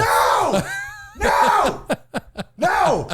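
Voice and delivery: high-pitched, hammed-up cries of refusal; mock outraged protest. Co-host heckling the earnest moral, mock outraged shouting. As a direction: cut in with comic, high yelps of denial.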